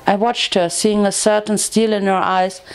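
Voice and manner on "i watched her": usual speaking voice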